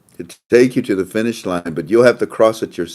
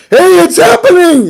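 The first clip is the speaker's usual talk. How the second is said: excited caller; 11 dB louder than usual. Retold or imitated. Teams called in by translators.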